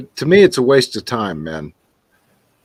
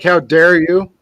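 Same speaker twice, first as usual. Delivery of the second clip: louder, higher mock indignation; mock pompous outrage; 5 semitones higher, 5 dB louder, slower than usual. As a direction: imitate an offended commenter, raised and rising at the end. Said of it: "one of those pompous asshole comments".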